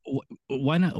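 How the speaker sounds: normal talking voice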